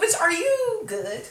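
high, drawn-out incredulous question; mock disbelief. Woman questioning herself after passing out, mock disbelief.

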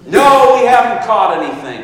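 loud, high, exasperated call; irritated shout back. Retold or imitated. Discouraged fisherman answering the man on shore, irritated shout. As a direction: yell it across the water, starting hard on "No".

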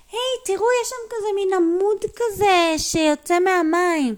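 put-on high, loud child-character call; excited calling out. Tami spotting a pillar in the water, excited calling.

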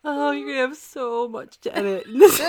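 high, loud, laughing until it sounds like crying; real laughing fit breaking into the words; laughing to tears. Real, not acted. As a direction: wail it through helpless laughter.

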